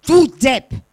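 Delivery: put-on annoyed boy yelling; angry shout. A narrator's reading. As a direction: hurl the insult at the mountains. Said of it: "Verärgert schreit der Junge".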